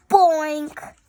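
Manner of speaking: loud punchy boink. Acted. Child reads the billiard sound loudly. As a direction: bounce the word out.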